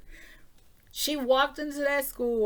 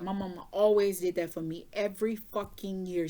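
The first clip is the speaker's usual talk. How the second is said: ordinary talk, nothing marked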